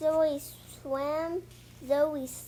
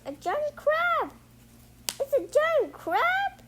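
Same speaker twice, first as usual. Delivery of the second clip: much higher, startled cry; alarmed squeal; 13 semitones higher, 5 dB louder than usual. Acted. Kids spot a giant crab, squealing high in alarm. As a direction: shriek it like the rock just turned into a crab.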